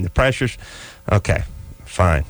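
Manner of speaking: ordinary talk, nothing marked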